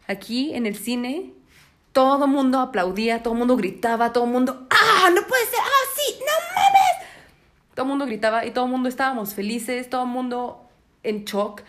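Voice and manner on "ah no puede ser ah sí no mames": high, loud excited squeals with a laugh; reliving the crowd going wild; excited screaming. Retold or imitated. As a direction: shriek like a thrilled fan in the theater. Retelling audience screaming.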